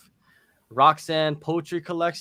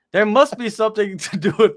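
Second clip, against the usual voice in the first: laughing; 4 semitones higher, 6 dB louder than usual. Real, not acted. Laughing about hot dogs.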